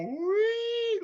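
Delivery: a very high rising electronic wee; high detector squeal. Retold or imitated. Imitating his metal detector's high squeal.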